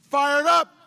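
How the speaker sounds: high, very loud rallying cry. Retold or imitated. Woman's voice suddenly behind him at the meeting, crying out. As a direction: belt it out across the room. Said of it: "this voice cry out".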